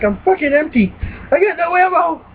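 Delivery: high, rising panic about ammo; panicked. Retold or imitated. Gamer panicking with an empty gun at enemies. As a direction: squeal it out in a rush of panic.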